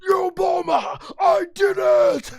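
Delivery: loud, high, triumphant shout; hammed-up boxing champ yell, stretched at the end; Rocky. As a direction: holler it like a victorious boxer. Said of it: "he does his best Rocky impression".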